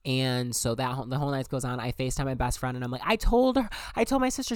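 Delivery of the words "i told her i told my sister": high, loud excited announcement; excited news. Retold or imitated. Telling best friend about coming out, excited.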